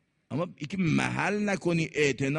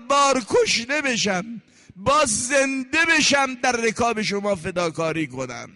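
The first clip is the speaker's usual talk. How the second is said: higher, impassioned pleading cry; dying Zuhayr's cry; 6 semitones higher than usual. Acted. Dying Zuhayr telling Husayn he would die again, higher fervent cry. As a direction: plead with your master through the pain.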